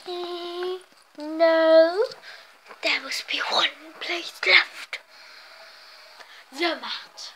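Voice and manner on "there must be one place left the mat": hushed suspenseful whisper; dramatic whisper. Pretend. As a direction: whisper like a detective closing in. Spooky search narration.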